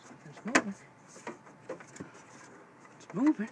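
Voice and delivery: ordinary talk, nothing marked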